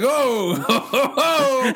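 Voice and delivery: high, hearty ho ho ho through laughter; belly-laugh like Santa; jolly mock Santa chuckle. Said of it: "Santa comes out like".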